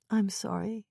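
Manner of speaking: soft apology. Acted. Wife apologizing softly.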